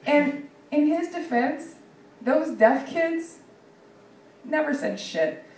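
mock earnest defense. Comic slowly feigns earnest defense of the pope. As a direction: defend him with straight-faced sincerity.

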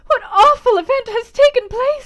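high, loud, dramatic demand; alarmed lady's cry. Acted. Lady seizes host's arm, high alarmed cry. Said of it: "said she".

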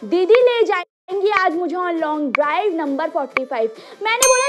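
ordinary delivery

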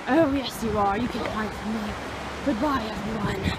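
loud, grand documentary host; mock tour guide. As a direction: announce the trek like an explorer. Continues grand tour narration.

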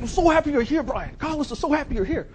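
overjoyed, very high and loud; Carlos Nelson. Retold or imitated. Giant client bear-hugs visitor, high overjoyed shout. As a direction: gush at full tilt, hammed-up. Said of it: "he puts me in this bear hug".

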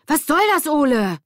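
high, loud, outraged demand; angry shout. A narrator's reading. Lilly grabbing Ole by the collar, shouting angrily. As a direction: shout it as an accusation.

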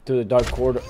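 low, soft, hurried bedtime-book read; rushed story reading. Acted. Player reading a storybook to the baby, rushed story reading. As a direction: rattle through the storybook quietly.

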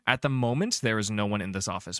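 normal talking voice

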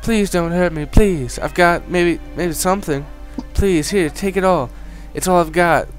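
high, loud panicked pleading; terrified begging. Acted. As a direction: beg for your life. NPC begs not to be hurt.

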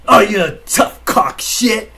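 slow, high, loud sneering taunt; mock tough-guy taunt. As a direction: sneer it like a bully. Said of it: "like that".

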